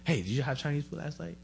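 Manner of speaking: ordinary talk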